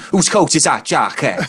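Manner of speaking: lilting put-on Welsh accent; mild Welsh impression. Shown as a demonstration. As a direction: do your one Welsh phrase, with a lilt. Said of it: "Welsh, I could do one phrase".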